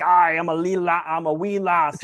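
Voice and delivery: a deliberately bad, hammed-up Irish accent; mock Irish, played for a laugh; shitty Irish accent. Drunk tourist mocking the Irish in a bad Irish accent. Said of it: "do your best shitty Irish accent".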